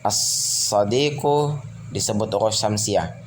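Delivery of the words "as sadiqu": As-sadiqu with a long held s and no l; lam left silent. Shown as a demonstration. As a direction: stretch the s of As-sadiqu in place of the lam. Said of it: "tidak dibaca alif lam".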